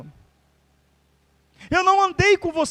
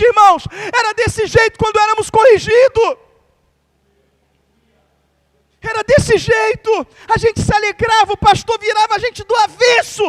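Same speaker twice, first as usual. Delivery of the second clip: loud, high fervent preaching; full-force preaching; 4 semitones higher, 5 dB louder than usual. Acted. Pastor preaching to the church, loud and fervent. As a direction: preach it out, building to a shout.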